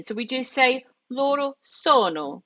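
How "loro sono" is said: LORO SONO with a long O and one short N; single N. Shown as a demonstration. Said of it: "it's just one N".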